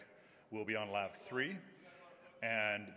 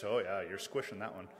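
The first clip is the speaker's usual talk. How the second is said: ordinary talk, nothing marked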